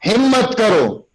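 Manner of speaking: ہمت کرو called high and strong; ہمت کرو hit hard with rousing force; right way: ہمت کرو as a rousing call; put life in the word. Shown as a demonstration. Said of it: "لفظ کے اندر جان ڈالنی ہے".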